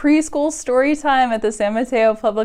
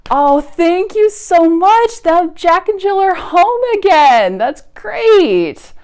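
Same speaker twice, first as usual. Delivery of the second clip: high, louder grateful cheer; thrilled thanks; 9 semitones higher, 7 dB louder than usual. Acted. Librarian thanks preschoolers for calling, thrilled. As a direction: thank the kids with delight.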